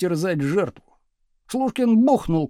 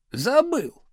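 higher, smirking pupil's reply; 6 semitones higher than usual. A narrator's reading. Pupil pretending to forget his surname, smirking. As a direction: toss it off with a smirk. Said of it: "ухмыльнулся он".

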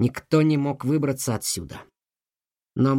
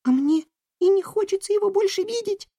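very high mouse squeak; squeaky voice. A narrator's reading. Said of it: "пропищала Бим".

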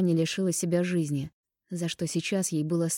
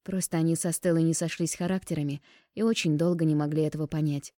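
plain reading voice

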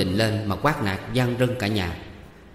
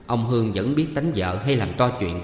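usual speaking voice

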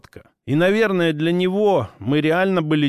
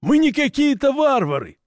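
indignant orc; 11 semitones higher than usual. Acted. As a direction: protest like an offended orc. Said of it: "искренне возмутился орк".

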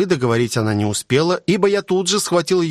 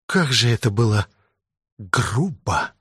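тихо, мурлыкающе; промурлыкай с нежностью; мурлыча; 2 semitones lower than usual. A narrator's reading. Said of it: "промурлыкала она". (мурлычет, сидя сверху)

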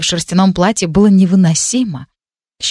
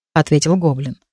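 usual speaking voice

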